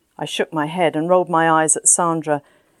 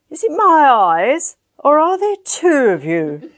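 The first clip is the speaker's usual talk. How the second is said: higher, slow, slurring amusement; drunken wonder; 9 semitones higher, 4 dB louder than usual. Acted. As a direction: marvel at seeing double. Drunk Danny seeing double.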